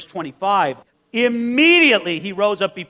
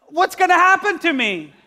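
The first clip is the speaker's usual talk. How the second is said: high, worried voice of the man on the bed; anxious paralyzed man; 9 semitones higher, 4 dB louder than usual. Acted. Paralyzed man on the bed fears what happens, high anxious voice. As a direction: fret about your fate, pitched up.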